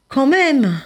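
insist, unwilling; mild mock indignation; reluctant protest. Rainbow fish protesting.

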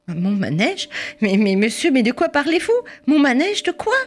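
can't believe the accusation; baffled mother. Acted. Mother stunned by the policeman's accusation, rising disbelief.

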